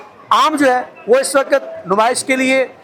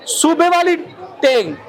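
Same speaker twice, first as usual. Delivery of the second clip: normal talking voice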